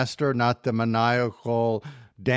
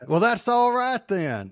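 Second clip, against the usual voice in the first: higher, smug mock quote; quoting the villain again; 9 semitones higher than usual. Host relishes the villain's smug catchphrase, pitched up. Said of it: "And then".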